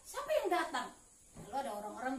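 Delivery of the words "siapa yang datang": higher-pitched curious question; bertanya penasaran. Retold or imitated. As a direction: wonder aloud, lightly acting the blind man. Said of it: "Dia tanya". (bertanya-tanya penasaran)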